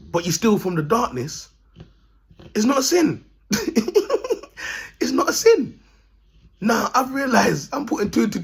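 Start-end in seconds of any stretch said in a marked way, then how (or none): 2.5-3.2 higher punchline. Real, not acted. 3.5-4.9 a squeaky burst of laughter; high-pitched laugh. Real, not acted. 5.0-5.8 higher punchline. Real, not acted. Repeated punchline.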